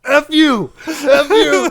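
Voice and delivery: high, loud offended outburst; angry yelling. Retold or imitated. Insulted coworker to the storyteller, angry yelling. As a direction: yell it as an acted blast of outrage. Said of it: "He starts yelling at me".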